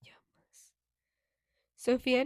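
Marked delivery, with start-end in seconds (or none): 0.0-0.7 breathy whisper of Jamás. A narrator's reading. Repeated under breath.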